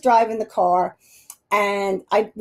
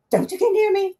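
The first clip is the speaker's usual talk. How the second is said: pitched way up, backing off in mock fright; hammed-up scared girl, higher; mock frightened plea; 10 semitones higher than usual. Friend scared of the psychic, higher mock fright. Julie scared, pulling away.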